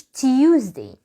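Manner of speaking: Tuesday drawn out as 'Ti-yuz-day'; wrong Tuesday. Shown as a demonstration. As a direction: mispronounce Tuesday with a spelt-out 'tee-you'. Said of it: "ما اسمهوش".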